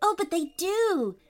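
put-on insistent little girl. A narrator's reading. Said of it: "insisted Poppy".

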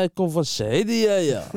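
high, loud, slow put-on accent; Moroccan accent. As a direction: mimic an old Moroccan man. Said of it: "Zeg maar".

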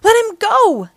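high, loud demand; demanding yell. A narrator's reading. April defends grabbed Casey, demanding yell. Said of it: "yelled April".

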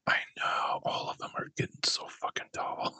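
hushed, gossipy whisper. Real, not acted. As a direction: lean in and whisper it like a secret. Gossiping about kids growing.

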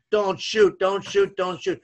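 mock shout of urgent instructions; retold yell. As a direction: re-enact yelling down the court, not at full volume. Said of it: "yelling at the top of my lungs".

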